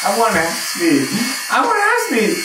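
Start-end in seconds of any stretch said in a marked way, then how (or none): 1.5-2.4 much higher, pleading; insistent high demand. Real, not acted. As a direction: insist on it, pitch way up. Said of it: "repeated insistently".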